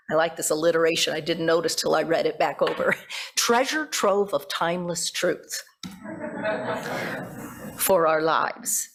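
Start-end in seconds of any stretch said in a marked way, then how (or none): none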